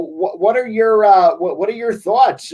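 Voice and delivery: normal talking voice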